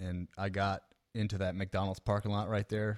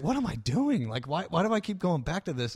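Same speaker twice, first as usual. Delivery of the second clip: loud, high panicked inner voice; frantic self-question; 8 semitones higher, 5 dB louder than usual. Retold or imitated. Comic onstage realizing his story is going wrong. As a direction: ask yourself loudly what you're doing. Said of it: "I was like".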